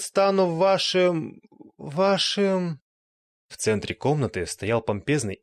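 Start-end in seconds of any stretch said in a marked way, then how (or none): 0.0-1.4 pompous words slowing to a stall; slowing grand vampire. Acted. Speech falters on seeing them. 1.8-2.8 repeated word fading off, deflated; faltering vampire. Acted. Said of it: "но вдруг затих".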